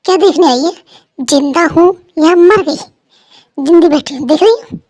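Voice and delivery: loud, cranky shout at a friend; wife snaps at her friend for visiting, loud and cranky; irritated snapping. Acted. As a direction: bite her head off for showing up.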